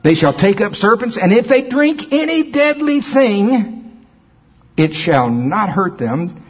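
slow, drawn-out scripture read; preaching scripture. Acted. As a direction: preach each word slowly. Slow emphatic scripture reading.

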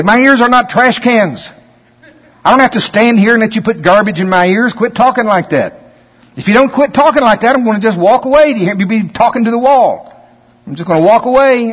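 high, loud, forceful telling-off; combative rebuke. Retold or imitated. Preacher reenacting rebuking a gossiper, high and loud. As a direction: scold the gossiper and threaten to walk off. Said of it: "I used to be very combative with it. I'd say".

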